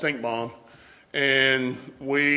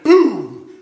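a sudden, loud, laughing boom; 8 semitones higher, 10 dB louder than usual. Retold or imitated. Telling friends about a hard fall, loud laughing boom. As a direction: slam the word out like hitting the ground. Landing hard after flipping over wire.

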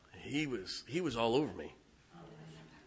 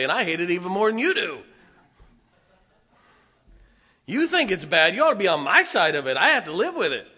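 much higher and louder preaching; preaching at full force; 8 semitones higher, 9 dB louder than usual. Real, not acted. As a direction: drive it home to the pews.

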